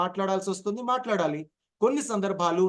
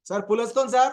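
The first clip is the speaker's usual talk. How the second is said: higher, louder, run straight through; urgent, no pauses; 5 semitones higher, 4 dB louder, faster than usual. Shown as a demonstration. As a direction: shout the warning without a break. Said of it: "పాజులు లేకుండా చెప్పాలి". (విరామం లేకుండా కంగారుగా)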